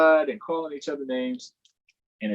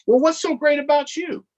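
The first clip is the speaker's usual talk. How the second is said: high, eager cartoon donkey; perky donkey pitching for votes; 7 dB louder than usual. Donkey asking kids for votes, high and eager.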